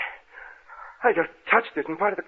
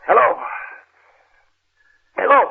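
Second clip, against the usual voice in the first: calling out; 7 semitones higher, 5 dB louder than usual. Acted. Calling out into the house.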